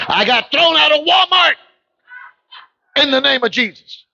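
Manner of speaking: shouted testimony. Real, not acted. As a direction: belt it out to the congregation.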